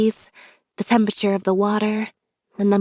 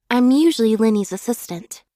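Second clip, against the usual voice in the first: normal talking voice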